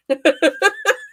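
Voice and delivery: high, rising laugh; real laugh. Real, not acted. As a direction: laugh at your own joke. Laughing at her own joke.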